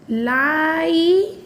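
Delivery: लाई with the vowel held long; slow teaching model of लाई; slow read-along. Shown as a demonstration. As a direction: draw out लाई for pupils to join in. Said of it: "फिर से एक बार साथ में पढ़िए".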